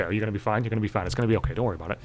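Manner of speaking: soft, hushed pep talk to himself; calm yourself down under your breath; quiet self-reassurance. Retold or imitated. Comic anxious man soothing himself, quietly. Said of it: "I probably say that to myself".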